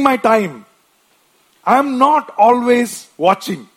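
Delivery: imitating a defensive person, hammed-up; mock denials. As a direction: play someone protesting too much. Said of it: "I want to name some deceptions".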